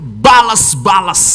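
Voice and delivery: ordinary talk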